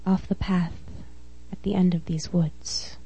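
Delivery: closing lines breathed out in a whisper; whispered close. Acted. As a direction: let the last line fade into a whisper.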